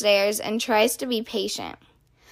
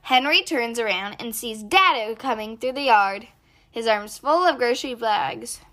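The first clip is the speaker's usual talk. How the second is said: plain reading voice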